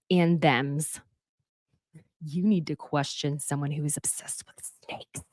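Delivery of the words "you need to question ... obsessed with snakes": unvoiced conspiratorial whisper; whispered warning. Telling the viewers about Rob's snakes, whispered. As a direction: whisper it like a secret.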